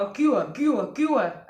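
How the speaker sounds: loud, pushy mock taunt from a wannabe gangster; imitating a rowdy boy shouting loudly. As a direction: jeer at him like a street tough. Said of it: "एक शैतानी सा ... भागा भागा आया".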